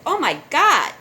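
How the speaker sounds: high, loud shocked gasp of words; shocked cry. Retold or imitated. Seeing the van hit the animal, a loud shocked cry. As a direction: blurt it as the van hits.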